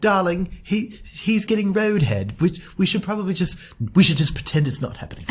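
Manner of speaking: a mild prim British "oh darling" voice; posh British couple. Retold or imitated. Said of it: "they're so British".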